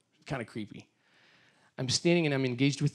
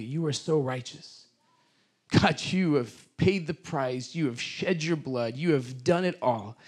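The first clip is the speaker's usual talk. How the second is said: fervent prayer; 3 semitones higher, slower than usual. Acted. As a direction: pour it out to God at full voice. Leads congregation in prayer.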